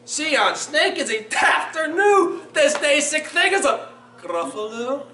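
high, incredulous scoff in Scots; gleeful, high-pitched Scots mouse; mocking mouse. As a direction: scoff merrily once the toad has gone. Mouse mocks fleeing snake in story.

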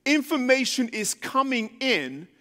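ordinary talk

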